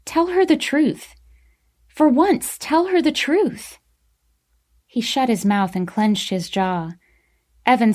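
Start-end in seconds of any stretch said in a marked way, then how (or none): 0.1-3.8 high-pitched, pleading demand; insistent. A narrator's reading. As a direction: urge him to confess, pitch up. Jenny presses Kellen to confess.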